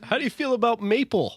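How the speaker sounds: hammed-up Canadian accent; bad Canadian accent. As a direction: tease like a cartoon Canadian. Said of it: "these accents are terrible".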